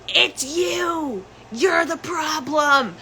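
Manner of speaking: sing-song blame from the family; mocking family. Family blaming her, voiced.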